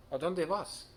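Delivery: mild storybook call. A narrator's reading. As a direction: call out the question, pitch lifted. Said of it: "called out".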